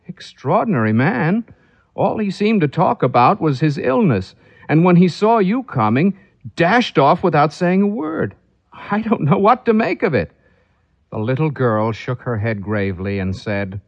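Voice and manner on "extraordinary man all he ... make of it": puzzled husband. Acted. Husband puzzled by fleeing guest.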